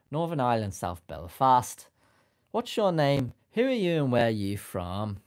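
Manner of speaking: loud Northern Irish accent, Belfast lilt; hammed-up Northern Irish accent. Shown as a demonstration. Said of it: "I think I could do a Northern Irish accent".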